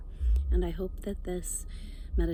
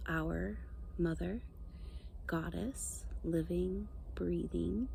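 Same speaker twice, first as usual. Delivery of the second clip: guided meditation. Real, not acted. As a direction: ease them down into calm, phrase by unhurried phrase.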